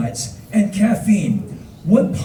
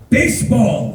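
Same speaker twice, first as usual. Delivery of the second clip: loud, raised-pitch exclamation; excited; 5 semitones higher, 5 dB louder than usual. Retold or imitated. Bus driver bonds with tourist, loudly excited. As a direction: imitate the driver proclaiming a shared love.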